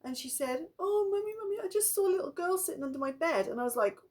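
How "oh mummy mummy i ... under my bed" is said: high, fast breathless telling; a daughter rushing to tell her mother what she saw; frightened child. Retold or imitated. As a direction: blurt it out scared. Frightened child reports ghost.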